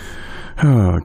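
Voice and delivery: long, low sigh; exasperated sigh. Real, not acted. Commentator tired of the revisions, exasperated sigh. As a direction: let out an exasperated breath.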